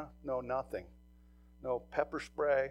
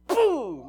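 loud mock boom; 10 semitones higher, 10 dB louder than usual. Officer acting out his baton strike on the drunk, loud. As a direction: punch the word boom out loud and high.

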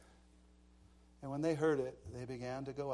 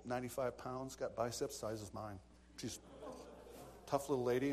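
ordinary talk, nothing marked

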